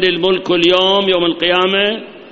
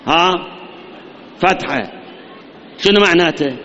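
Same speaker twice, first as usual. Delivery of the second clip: usual speaking voice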